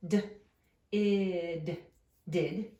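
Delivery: d, id, did blended slowly; sounding out did. Shown as a demonstration. Teacher sounding out did for the class. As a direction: sound out each part of did, then blend. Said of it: "we're just gonna have to sound out".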